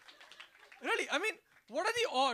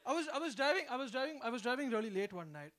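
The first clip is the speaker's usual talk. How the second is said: ordinary talk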